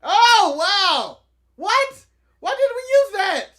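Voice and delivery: high, loud, incredulous outburst; loud astonishment. Real, not acted. Co-host reacting to the thumbnail picture, astonished. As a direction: blurt it out in real surprise.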